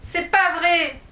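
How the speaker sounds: crying out. Acted. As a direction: cry out in a child's outrage. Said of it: "s'écria Alice".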